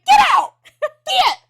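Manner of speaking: high, very loud retold scream; hammed-up frantic yell; panicked shout. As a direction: drive an intruder out, shrieking. Acting out ordering intruder out.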